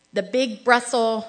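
ordinary talk, nothing marked

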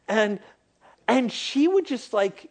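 usual speaking voice

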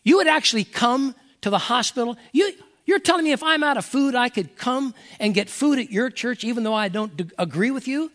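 an outsider stunned that a church would help; surprised disbelief. Retold or imitated. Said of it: "and have people go".

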